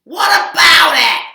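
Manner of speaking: loud high whiny retort; loud high crying retort; snappy cow. Acted. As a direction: snap back loudly. Cow snaps back.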